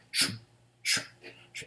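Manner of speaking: voiceless "sh" bursts like a shaker; shaker sound. Retold or imitated. Reviewer imitates the song's shaker rhythm with breathy sh sounds. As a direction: hiss it out like a shaker. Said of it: "this kinda shaker rhythm".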